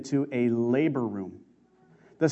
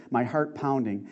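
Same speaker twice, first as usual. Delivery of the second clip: ordinary delivery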